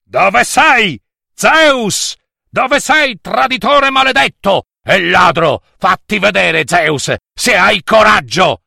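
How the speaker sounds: loud, high angry shouting; yelling furious father. Acted. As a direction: roar the challenge through the cave. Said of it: "entrò urlante nella grotta".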